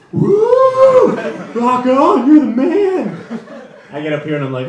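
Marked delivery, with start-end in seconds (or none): 0.1-3.2 high, loud whooping fan; cheering crowd. Retold or imitated. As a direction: whoop like a thrilled audience. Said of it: "everyone's like".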